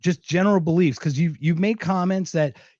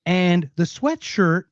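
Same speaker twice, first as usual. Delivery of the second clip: normal talking voice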